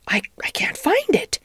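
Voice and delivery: high, faltering, half-whispered worry; anxious. A narrator's reading. Duchess fumbling for the patty pan, anxious. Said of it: "she said anxiously".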